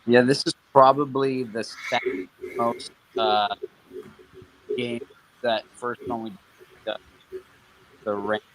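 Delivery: muffled bad mic, cutting out; choppy underwater connection. Real, not acted. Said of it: "you sound like you're underwater and you're skipping out".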